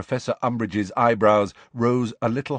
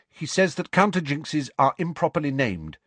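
higher, clear schoolgirl voice; prompt; 6 semitones higher than usual. A narrator's reading. Pupil answers teacher's test question, prompt. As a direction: answer readily, like a keen pupil. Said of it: "said Hermione promptly".

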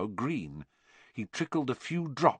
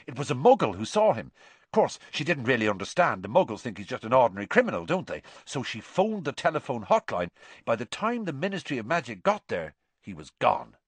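fast, higher schoolboy gossip; excited Seamus; 4 semitones higher, faster than usual. Acted. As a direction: rattle off the news, slowing at the end.

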